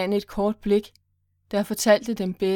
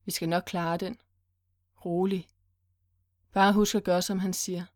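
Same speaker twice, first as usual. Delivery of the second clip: reassuring. Acted. Forces smile to give her courage.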